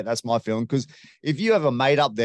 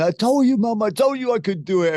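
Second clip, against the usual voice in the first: emotional fighter to mom; 11 semitones higher than usual. Retold or imitated. Champion telling his mom on the phone, high and emotional. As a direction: voice him breaking with emotion, pitched up.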